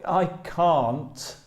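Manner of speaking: "can't" with the flat a of "cat"; American-style "can't", rhyming with "ant"; attempted American can't. Shown as a demonstration. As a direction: try an American vowel in "can't". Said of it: "pronunciation in American English is slightly different".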